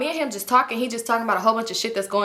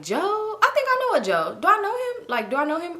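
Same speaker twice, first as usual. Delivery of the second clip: high mock puzzled guy; 8 semitones higher than usual. Clyde quoted feigning recognition.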